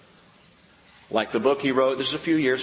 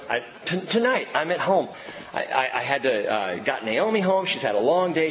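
usual speaking voice

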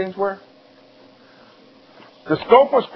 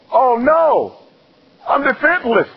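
hammed-up cry of helplessness, higher; mock helpless; 7 semitones higher than usual. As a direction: play it as fake panic. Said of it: "I go".

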